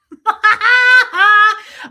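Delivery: big shrieking burst of laughter; loud high cackle. Real, not acted. Amused at therapist's verdict, loud cackle.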